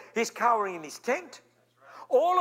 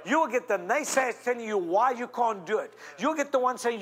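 ordinary talk, nothing marked

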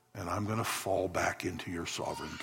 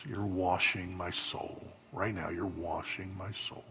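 lower, hushed prayer leading the room; 3 semitones lower than usual. Acted. Leads congregation in prayer.

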